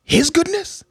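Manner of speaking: high rising mock-astonished question; acted surprise. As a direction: feign big surprise, voice leaping up. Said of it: "I act surprised and go".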